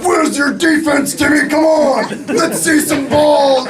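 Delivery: high-pitched, drawn-out sideline shouting; sports-parent yelling. Acted. Crazy parent yelling at eight-year-olds on the field, loud high shouting. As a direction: holler like a crazed sports parent. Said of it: "they're yelling at a bunch of eight-year-olds".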